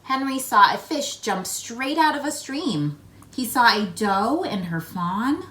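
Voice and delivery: put-on lively read-aloud for young children; story narration. A narrator's reading. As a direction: tell it like a picture book to kids. Reads picture book aloud.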